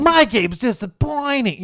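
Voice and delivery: very high, loud cartoon hero voice; loud, drawn-out game-character impression; Sonic. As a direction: cry it out in a high put-on mascot voice. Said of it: "his Sonic impression's actually pretty good".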